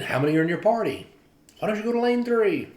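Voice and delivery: quickly sort the travellers into lanes; imitating the greeter.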